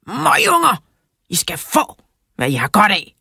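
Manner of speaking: high outburst dropping into a threat; angry shout. A narrator's reading. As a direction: shout a threat at cheeky kids, starting high. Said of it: "råbte han".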